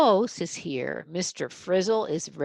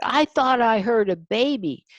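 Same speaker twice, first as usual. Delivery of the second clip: loud, higher exclamation; an annoyed man crying out; cried out; 6 semitones higher, 8 dB louder than usual. Acted. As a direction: call out in alarm. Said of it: "he cried".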